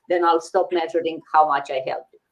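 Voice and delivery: ordinary delivery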